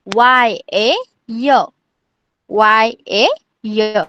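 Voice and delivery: वाई ए य in long, separate beats; spelled-out pronunciation. Shown as a demonstration. Teacher showing pupils how to pronounce य, spelled out slowly. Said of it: "य को कैसे pronounce करना है".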